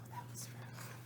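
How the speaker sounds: hushed aside; whisper. Acted. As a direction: breathe it as a secret. Aside after the insult.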